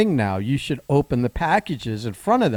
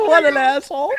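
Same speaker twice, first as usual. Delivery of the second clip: laughing; 12 semitones higher than usual. Real, not acted. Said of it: "laughing at the story".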